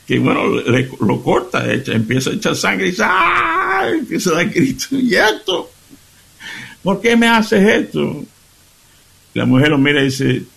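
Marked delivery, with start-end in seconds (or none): 3.1-4.9 high hammed-up cry of pain; mock pain cry. Husband bleeding after pan blow, hammed-up cry. 4.9-8.2 high, hurt complaint; mock wounded protest. Bleeding husband asking his wife why, high hurt protest.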